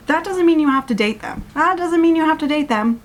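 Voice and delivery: high-pitched, emphatic protest; exasperated insistence. Real, not acted. Insisting against the book's romance logic.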